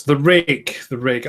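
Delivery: plain reading voice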